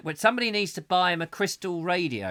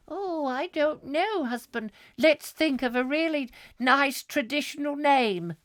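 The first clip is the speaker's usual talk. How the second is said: high prim wife voice; 9 semitones higher than usual. Acted. Wife answering husband about baby, high prim voice. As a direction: pitch it up as the fussing wife.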